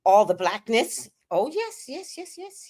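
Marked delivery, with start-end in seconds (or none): 1.3-2.6 high gleeful yes. Acted. Gleeful affirming.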